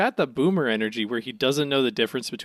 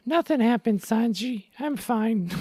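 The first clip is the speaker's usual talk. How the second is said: high cartoon voice, raised well above normal; Zoro's voice; 8 semitones higher, slower than usual. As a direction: pitch it way up like a cartoon swordsman. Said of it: "this is the now Zorro's voice".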